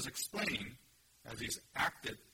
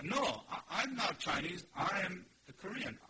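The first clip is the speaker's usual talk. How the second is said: "I am a Korean" pushed higher, with weight on "I"; emphatic correction; 6 semitones higher, 4 dB louder than usual. Shown as a demonstration. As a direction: insist firmly, stressing "I am". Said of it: "I can be emphatic".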